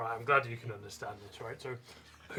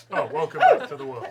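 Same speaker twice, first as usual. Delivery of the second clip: high, loud delighted cry; loud laughing greeting; 13 dB louder than usual. Real, not acted. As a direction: shout it through laughter.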